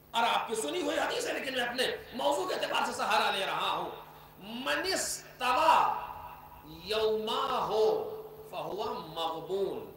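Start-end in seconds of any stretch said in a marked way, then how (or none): 4.4-9.9 very slow, lowered Arabic recitation; solemn recitation. Acted. Preacher reciting the Imam's hadith to the gathering, slow and solemn. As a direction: intone the hadith with reverence.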